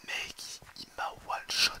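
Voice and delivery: hushed, breathless disbelief; stunned whisper. Real, not acted. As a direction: whisper it in shock. Stunned after dying.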